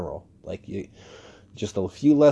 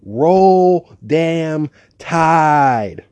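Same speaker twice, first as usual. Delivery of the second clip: very slow, much higher and louder Roll damn Tide; drawn-out cheer; 8 semitones higher, 11 dB louder, much slower than usual. Retold or imitated. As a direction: stretch it out like a stadium chant. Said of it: "she just goes".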